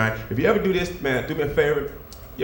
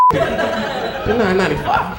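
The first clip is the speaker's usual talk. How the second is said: ordinary talk, nothing marked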